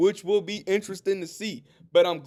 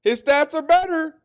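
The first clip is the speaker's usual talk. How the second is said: mock objector; 9 semitones higher, 7 dB louder than usual. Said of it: "So you might say".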